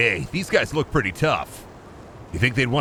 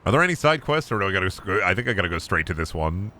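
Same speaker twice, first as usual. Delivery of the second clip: ordinary delivery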